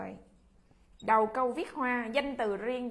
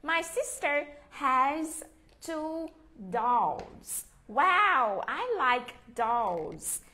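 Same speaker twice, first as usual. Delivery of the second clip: very slow "My sister has two dolls"; slow model sentence; much slower than usual. Shown as a demonstration. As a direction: drag it out for pupils to copy. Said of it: "teacher models slow sentence".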